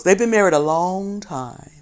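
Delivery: ordinary delivery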